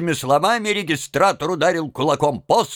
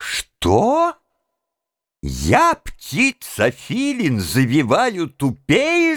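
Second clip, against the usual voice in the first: beside himself with anger; 2 semitones higher, much slower than usual. A narrator's reading. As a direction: draw out each word, affronted. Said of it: "закричал конректор вне себя от гнева". (вне себя от гнева)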